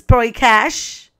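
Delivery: Prakash said as "PRAY-cash"; mispronounced name. Acted. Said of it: "Jinny says Prakash. Instead of Jaya".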